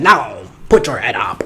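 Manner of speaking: bossy Edna instructing you to lift your head; Edna impression. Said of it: "She said".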